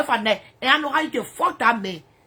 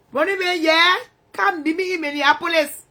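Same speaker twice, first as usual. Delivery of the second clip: usual speaking voice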